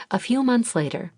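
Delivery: ordinary delivery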